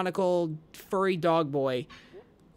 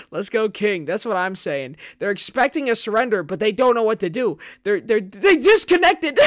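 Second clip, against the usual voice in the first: higher and louder, building to a shout; hyped shout; 5 semitones higher, 10 dB louder than usual. Real, not acted. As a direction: celebrate loudly. Hyped winning moment.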